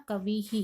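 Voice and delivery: कविः: say the final visarga as a light ह echoing इ; visarga pronounced. Shown as a demonstration. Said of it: "last में ई आया तो मैंने क्या बोला". (कविः को अंत में हल्के ह के साथ बोलें)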